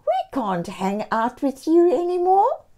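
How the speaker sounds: a touch of regret in a cat voice; lightly voiced kitty breaking off the friendship; sad cat friend. A narrator's reading. Cat character Bushy voiced.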